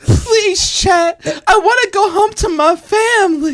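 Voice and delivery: high, hammed-up tearful plea; mock pleading. Captive begging chat for release, mock tearful. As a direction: beg in an exaggerated high wail.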